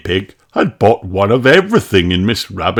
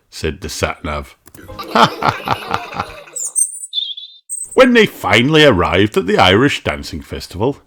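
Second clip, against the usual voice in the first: ordinary delivery, nothing marked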